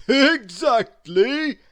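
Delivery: loud, slow, beaming Santa voice. A narrator's reading. Santa answering the snowman: loud beaming Santa voice. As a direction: boom it with delight. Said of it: "beamed Santa Claus".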